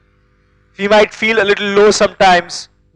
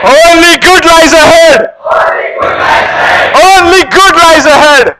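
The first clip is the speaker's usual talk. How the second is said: shouted affirmation; 6 semitones higher than usual. Shown as a demonstration. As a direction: belt it out to the whole hall. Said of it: "affirmations led aloud to crowd".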